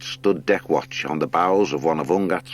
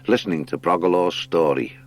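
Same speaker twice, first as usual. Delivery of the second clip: plain reading voice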